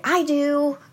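a light, lightly voiced child answering up high; eager child. Children answering the teacher, lightly voiced eager child. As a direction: chirp it like an eager little kid. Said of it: "they would say".

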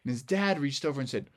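plain reading voice

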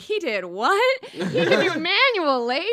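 high, loud, drawn-out disbelief; mock astonishment. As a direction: stretch out the mock shock. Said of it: "And I'm like".

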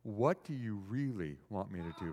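low, soft, searching question; tender Jesus. Acted. Jesus asks Bart what he truly needs, softly and tenderly. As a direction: ask it gently, almost confidingly. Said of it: "And Jesus was saying".

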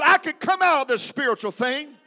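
loud call for the congregation to repeat; rallying shout. Shown as a demonstration. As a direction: declare it forcefully from the pulpit.

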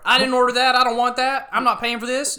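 high, very loud indignant yelling; outraged homeowner. Acted. Homeowner to the dish installer, outraged shouting. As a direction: yell at an intruder on your roof.

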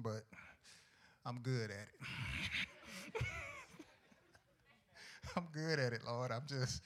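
quiet mock prayer. Churchgoer confessing sharp tongue to God, soft. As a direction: admit it softly with a grin.

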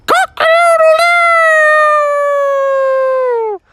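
high, long drawn-out cock-a-doodle-doo; rooster crow. Acted. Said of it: "they heard".

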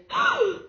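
very high, exaggerated gasp of surprise; delayed child's gasp. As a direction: gasp like a toddler spotting the elf. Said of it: "really delayed".

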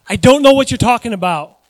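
loud, defensive denial; emphatic denial. Acted. Peter to servant girl, loud flustered denial. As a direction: deny it forcefully.

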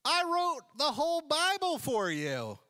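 a bemused, exasperated God, stretching the last lines out; God's exasperated voice. Retold or imitated. God looking at a stubborn believer, high-pitched exasperated scolding. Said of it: "God's looking at you like".

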